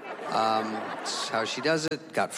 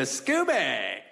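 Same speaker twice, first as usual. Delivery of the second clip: drawn-out name call; calling the dog. Retold or imitated. Coming over to see Scooby, calling out. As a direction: greet the dog with a stretched call.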